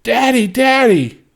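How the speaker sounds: very loud, high scream; a little girl screaming for her daddy; child screaming. A narrator's reading. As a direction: shriek for her father. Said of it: "she screamed".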